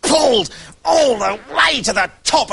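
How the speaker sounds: the climb ends in a strained cry; straining cry. A narrator's reading. Climax of the pulling.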